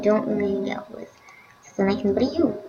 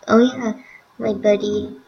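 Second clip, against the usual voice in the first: ordinary delivery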